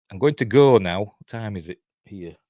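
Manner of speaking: ordinary delivery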